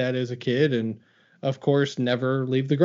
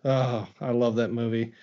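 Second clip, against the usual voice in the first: ordinary talk, nothing marked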